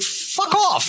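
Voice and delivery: high scornful brush-off; dismissive. Acted. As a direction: sneer it away in a high voice. Dismissive retort, high far.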